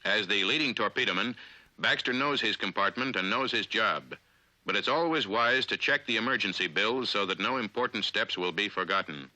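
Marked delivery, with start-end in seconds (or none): none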